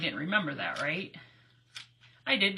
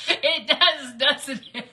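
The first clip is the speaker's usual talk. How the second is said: real laugh, loud and high; 6 semitones higher, 8 dB louder, much slower than usual. Real, not acted. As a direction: crack up while agreeing, voice jumping up. Cracking up at a viewer's comment.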